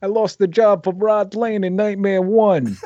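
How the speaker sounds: high-pitched hammed-up actor; mock boasting bad-actor voice; imitating Sal. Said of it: "let's look at Sal's acting talent".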